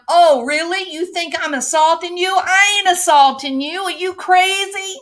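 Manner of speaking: hammed-up furious questioning; mock angry yelling. Woman yelling at husband down the aisle, mock angry. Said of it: "as she's questioning him".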